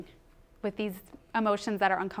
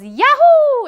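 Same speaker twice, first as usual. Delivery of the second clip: much higher, much louder cheer; the brain's dopamine cheering, much higher and louder; joyful whoop; 18 dB louder than usual. Retold or imitated. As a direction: let the brain cheer wildly. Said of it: "the dopamine goes".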